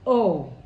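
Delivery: O said like the o in go; teacher showing the Chichewa vowel; vowel sound O. Shown as a demonstration. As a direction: model O as in go. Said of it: "Like O in go".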